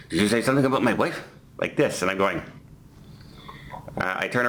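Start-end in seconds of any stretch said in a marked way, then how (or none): 0.1-1.2 fast mumble without opening the mouth; mumbled through closed mouth. Retold or imitated. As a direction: mimic a stranger muttering with a barely moving jaw. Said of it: "talking without opening his mouth".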